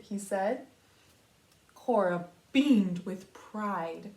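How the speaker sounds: lively storybook read-aloud; reading to children. A narrator's reading. Teacher reading a picture book to her class, storybook voice. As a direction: read it out for young listeners.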